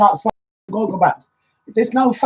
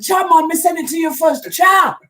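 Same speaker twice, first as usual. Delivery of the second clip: imitating a vexed friend, much higher, rising to the final cha; mock telling-off, half-hearted and pitched way up; Daddy Oddie vexed; 11 semitones higher, 4 dB louder than usual. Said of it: "Daddy Oddie said".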